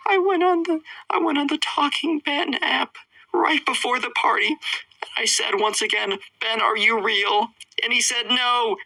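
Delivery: very high put-on tearful kid voice; Trevor. Boy explains his fear to the girl, mock tearful. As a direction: whimper it high like an upset little boy. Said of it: "full of tears".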